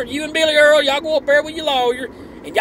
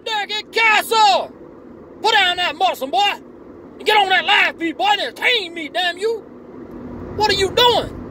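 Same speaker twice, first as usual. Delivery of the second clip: put-on a fired-up Southern good ol' boy hollering at his hero; 6 semitones higher than usual. Biggest fan scolding Scooter for skipping the live feed, hollering.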